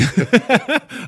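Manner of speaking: high, loud burst of laughter; disbelieving laugh. Retold or imitated. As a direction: laugh out the shock.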